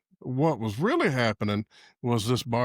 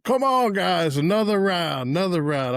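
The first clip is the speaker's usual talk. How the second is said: retold pushy bartender; 5 semitones higher than usual. Bartender pushing free shots on the guys, pushy and coaxing. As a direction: imitate a bartender egging them on.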